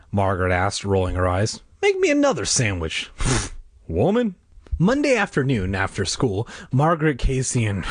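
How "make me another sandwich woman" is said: cheeky demand. A narrator's reading. Teasing brother demands.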